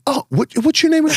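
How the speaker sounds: high-pitched mocking imitation of a woman; mimicked snub. Fitness-industry woman who keeps forgetting him, high mocking imitation.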